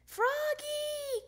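high, drawn-out call of the name. A narrator's reading. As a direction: sing out the name, stretched and high. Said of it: "called Miss Otterbottom".

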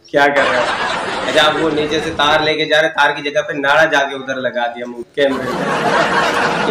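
ordinary talk

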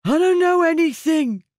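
high, loud, panicked denial; frightened exclamation. A narrator's reading. Prisoner denying everything to his captors, high frightened cry. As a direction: blurt it out in terror, pitched high. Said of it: "the man exclaimed".

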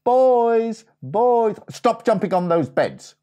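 high, loud call upstairs. Acted. Mum calls up to her boys, loud high call. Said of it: "She called up".

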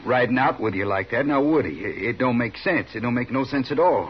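talking himself into a fury; rising agitation. Acted. Jealous husband arguing with his wife, building anger.

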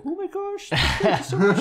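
put-on high, squeaky grateful old lady. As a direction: play a sweet old lady thanking you. Old customer thanking, high voice.

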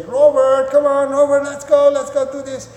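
high, loud excited call to a dog; acted over-eager owner; exciting owner hype. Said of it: "sounds and feels funny and fun and exciting".

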